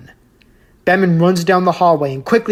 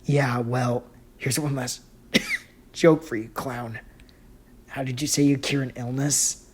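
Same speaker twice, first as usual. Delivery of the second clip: injured, weak voice; 4 semitones lower, 8 dB quieter than usual. Acted. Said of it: "the injured boy says".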